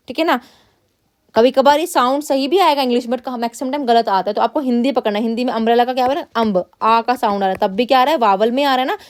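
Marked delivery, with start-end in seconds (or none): none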